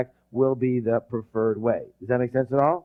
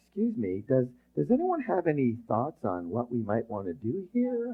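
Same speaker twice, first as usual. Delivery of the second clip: polite, hesitant asking; 2 semitones lower, 7 dB quieter than usual. Acted. Leader asking troops for thoughts in battle, politely and quietly. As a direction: timidly ask for everyone's thoughts. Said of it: "You're not gonna say something like".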